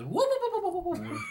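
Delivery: very high whooping; rapid string of high-pitched comic whoops; Yoda. Retold or imitated. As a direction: imitate a little creature whooping through a fight. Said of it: "where Yoda, as he fighting Count Dooku, he says".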